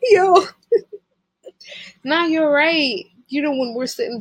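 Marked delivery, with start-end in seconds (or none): 0.1-0.4 high breathless laugh bursting out; cracking up, then a loud laughing yo; burst of laughter. Real, not acted. Partner's joke cracks her up.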